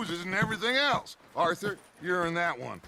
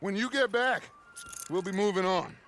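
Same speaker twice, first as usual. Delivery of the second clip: normal talking voice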